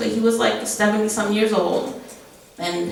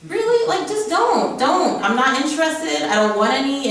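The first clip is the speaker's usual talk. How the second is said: exasperated; 8 semitones higher than usual. Retold or imitated. Retorting to men hitting on her.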